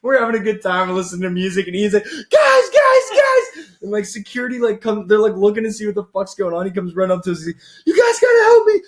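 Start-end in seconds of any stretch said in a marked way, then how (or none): 2.0-3.7 hammed-up falsetto panic shout; Ian in panic. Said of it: "full panic". 7.8-8.8 loud falsetto mock plea for help; Ian in panic. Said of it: "full panic".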